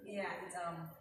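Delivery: normal talking voice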